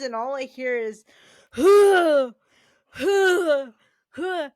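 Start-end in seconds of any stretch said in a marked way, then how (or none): 1.5-4.5 loud, high huffs of heavy breathing; imitated weightlifter's heavy-breathing grunts; exaggerated gym grunting. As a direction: grunt like a huge lifter straining. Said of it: "the grunting and the heavy breathing".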